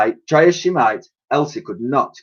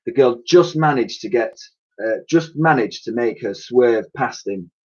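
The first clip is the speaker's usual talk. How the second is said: ordinary delivery, nothing marked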